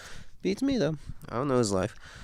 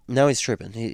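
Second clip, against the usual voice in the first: usual speaking voice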